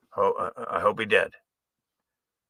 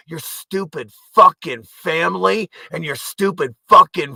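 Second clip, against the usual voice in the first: higher, louder screaming rant; furious shout; 8 semitones higher, 8 dB louder than usual. Real, not acted. Ranting at viewers, screaming with fury. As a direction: scream it in their faces.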